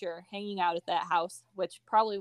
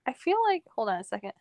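normal talking voice

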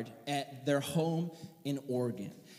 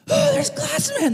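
dazed mock panic; 9 dB louder than usual. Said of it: "I'm like".

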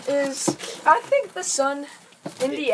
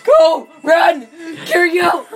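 much louder hammed-up yells; mock shouting; 5 semitones higher, 10 dB louder, slower than usual. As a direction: holler in pretend panic. Fleeing pretend monster.

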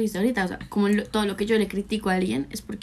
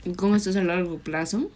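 talking while eating pizza; words round a bite of food; mouth full. Real, not acted. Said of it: "Qué pena hablar con la boca llena".

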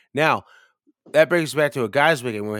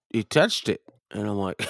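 normal talking voice

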